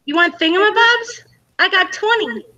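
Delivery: high, playful imitation of a mermaid heroine; half-sung high heroine impression; Ariel. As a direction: quote the cartoon song in a high voice. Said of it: "the Little Mermaid from Ariel".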